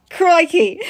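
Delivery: loud, high mock-Australian exclamation; Australian [name]. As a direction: exclaim in a hammed-up Aussie accent. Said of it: "Australian [name]".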